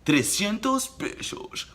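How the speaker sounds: high, slow, hammed-up Spanish price call; Dominican vendor. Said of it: "So they always said".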